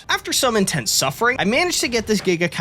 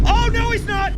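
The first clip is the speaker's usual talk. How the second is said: high excited shout; 3 dB louder than usual. Real, not acted. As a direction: yell it in delighted surprise. Surprise rescue in game.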